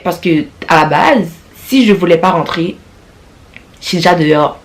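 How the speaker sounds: ordinary talk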